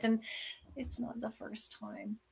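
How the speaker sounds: ordinary talk, nothing marked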